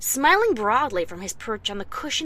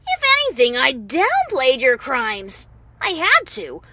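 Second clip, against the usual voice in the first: much higher and louder, furious; angry yell; 4 semitones higher, 6 dB louder than usual. Acted. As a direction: let the rage out at the villain. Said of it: "I couldn't keep from yelling".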